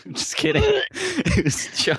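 high, giggling take-back; laughing. Real, not acted. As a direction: laugh it off as a joke. Laughing off his joke.

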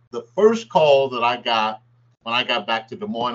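usual speaking voice

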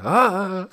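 high moan overheard through a door; comic high moan; imitated moan. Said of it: "but I hear".

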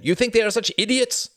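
high, loud, indignant question; snarled. A narrator's reading. Pilot defending the plane makers, snarling. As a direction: throw it back at him angrily. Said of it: "snarled Jorgen".

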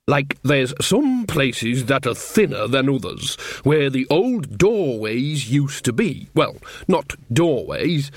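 slow, halting rustic storyteller; Nanny Ogg, groping to explain. Acted. Mother explaining thin places to her son, hesitant. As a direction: feel your way slowly through a muddled explanation.

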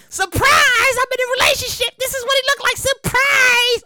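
high, sing-song mock celebrity announcement. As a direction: chirp it like a coy influencer.

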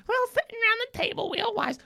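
very high, silly wife voice; mock old wives. Host acts out wives' table pun, high mock voice. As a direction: pipe up as a gaggle of wives.

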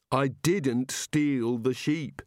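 very slow, weighty "didn't steal the sheep"; insistent, word-by-word denial; emphatic denial. Acted. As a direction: spell out "didn't" slowly and firmly. Prisoner insists on his innocence.

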